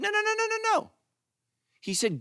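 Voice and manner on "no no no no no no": much higher, rapid run of nos; emphatic no. Retold or imitated. Nehemiah stopping the weeping people, high insistent no.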